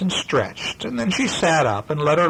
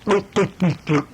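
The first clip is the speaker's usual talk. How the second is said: higher, playful bloop sounds; squishing noise; 7 semitones higher than usual. Acted. Sister squashing brother's clay dinosaurs. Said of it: "She took his dinosaurs and went".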